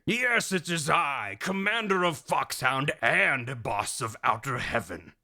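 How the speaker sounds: slow, grandiose villain reveal; hammed-up commander voice; Big Boss. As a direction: announce yourself with stagey pomp. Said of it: "Big Boss, what are you doing here".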